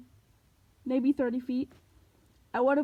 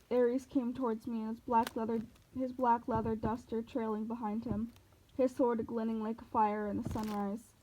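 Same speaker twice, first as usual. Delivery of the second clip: ordinary delivery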